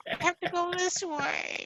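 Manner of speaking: mock a kid who won't turn the phone; whiny young person impression. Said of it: "What a perfect impersonation".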